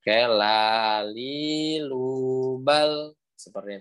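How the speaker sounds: "lali lubal" stretched out very slowly; slow practice recitation. Shown as a demonstration. Teacher giving students a slow practice model. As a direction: drawl "lali lubal" for them to copy. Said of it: "Silakan teman-teman praktekkan".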